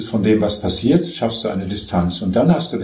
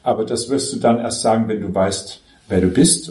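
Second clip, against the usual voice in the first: usual speaking voice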